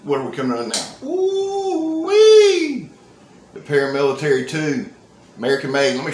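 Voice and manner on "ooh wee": much higher, loud delighted ooh-wee; excited whoop. Real, not acted. Spotting the next knife, a loud delighted whoop. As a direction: let it burst out in delight.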